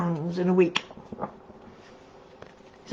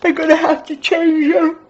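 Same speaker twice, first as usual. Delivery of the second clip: laughing; 9 semitones higher, 8 dB louder than usual. Real, not acted. Laughing about the duck ringtone.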